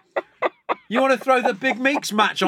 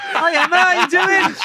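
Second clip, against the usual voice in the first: bright, higher mock welcome to a guest; mock greeting; 7 semitones higher than usual. Host pretends to welcome Emma to the dinner, bright and higher.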